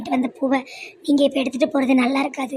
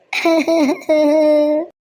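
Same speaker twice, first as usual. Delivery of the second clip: a loud rising sob; rejected girl breaks down wailing; loud crying; 5 dB louder than usual. Acted. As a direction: burst into a wailing cry. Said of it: "இந்த பிள்ளை என்ன அழுவ அழுது".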